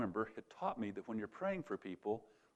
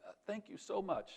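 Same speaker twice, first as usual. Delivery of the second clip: much higher, soft and grateful; grateful thanks; 10 semitones higher, 4 dB quieter than usual. Retold or imitated. Student thanking his teacher, much higher and soft. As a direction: breathe out your gratitude.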